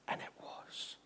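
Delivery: whispered and it was; softly unvoiced. A narrator's reading. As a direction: drop to a whisper. Hushed after calming.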